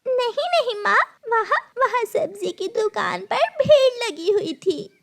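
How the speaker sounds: put-on very high, pleading child voice; frightened little girl. Gudiya explains the crowd at the shop to stepmother, pleading. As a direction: protest anxiously, high and girlish.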